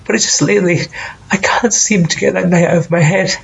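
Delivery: high, choked-up confession, close to tears; tearful. Acted. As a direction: voice climbing as the upset takes over. Monologue breaking down over that night.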